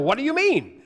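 high, loud, indignant comeback; affronted retort. Retold or imitated. Young student taking affront at the professor's question, indignant. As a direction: fire it back as if slapped.

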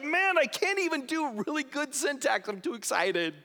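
too thrilled to form sentences; imitating overexcited John. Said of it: "He's like".

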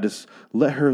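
ordinary talk

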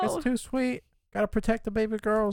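very high, whiny mock crying; tearful whine. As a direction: squeak it out as if welling up. Acting out tearful Jake.

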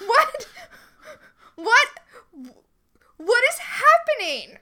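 high, loud, stunned questions; baffled by the plot; real disbelief, laughing; incredulous. Real, not acted. Disbelief at episode.

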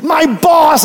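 mock exasperation; stressed commercial lady. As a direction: wail it like a frazzled woman in an ad. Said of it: "She was so stressed".

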